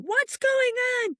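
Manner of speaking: very high, slow mock scream; retold scream. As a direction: imitate the friend's panicked scream as she bursts in.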